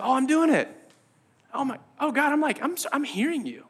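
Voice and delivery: high, softly amazed joy; acted-out thrilled discovery; delight. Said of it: "this place of delight".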